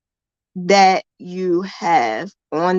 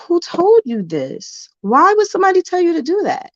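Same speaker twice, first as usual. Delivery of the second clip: incredulous; 11 semitones higher than usual. Retold or imitated. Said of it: "And I'm like".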